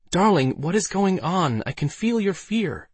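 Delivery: high, drawn-out pleading female voice; concerned Alice. A narrator's reading. Alice to fleeing Waldo, sensing his fear, concerned. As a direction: stretch it out up high, worried for him. Alice asks as they flee.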